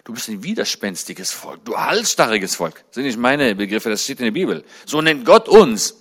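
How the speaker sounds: usual speaking voice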